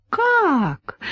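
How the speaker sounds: a high gasping "Как?"; gasped surprise. A narrator's reading. Polina gasps at her sister's news, high surprise. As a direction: gasp it out in shock, way up high. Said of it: "ахнула Полина".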